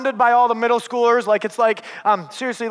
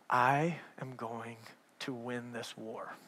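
slow, much softer and lower menacing vow; 12 semitones lower, 18 dB quieter, slower than usual. Retold or imitated. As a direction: growl it quietly like a war oath. Pastor saw red, vows revenge.

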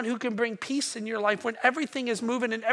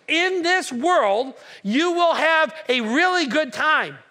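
high, loud, drawn-out proclamation; forceful preaching; 5 semitones higher, 5 dB louder, slower than usual. Acted. Preacher quoting Jesus against prosperity gospel, loud and emphatic. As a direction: preach it slowly at full force, every word weighted.

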